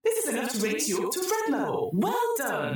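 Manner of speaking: high, bright AI announcer; the computer rewarding the troubleshooters, in a chirpy synthetic voice; cheery computer voice. Acted. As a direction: sing out the congratulations like a cheerful machine. Computer continues.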